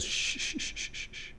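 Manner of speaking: whispered shh, very soft and fading; soothing shush. Retold or imitated. The Lord hushing an agitated, frustrated believer, whispered shushing. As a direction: hush them like settling a frightened child. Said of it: "He says".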